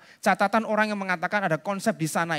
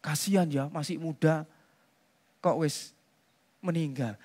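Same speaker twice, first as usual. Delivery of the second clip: much softer, lower sigh of pity; lightly imitated condolence; mock pitying mourner; 6 semitones lower, 8 dB quieter than usual. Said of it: "kita ngomongnya".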